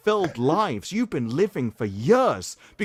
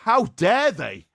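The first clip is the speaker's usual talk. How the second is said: slow, loud, high how dare they; hammed-up indignation; scandalised critic parody; mock outrage; 4 semitones higher, 5 dB louder, slower than usual. Mock outrage at kissing couple.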